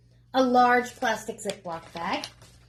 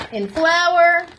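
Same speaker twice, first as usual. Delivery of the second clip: louder, drawn out flour; emphatic; 5 semitones higher, 8 dB louder than usual. Shown as a demonstration. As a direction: lean on in flour. Repeats cooking step, emphatic.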